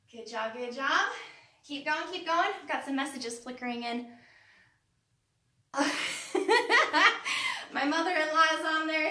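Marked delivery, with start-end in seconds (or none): 5.7-7.7 a burst of bright laughter, higher and louder; loud real laugh. Real, not acted. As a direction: laugh out loud at a funny message. Laughing at a chat message.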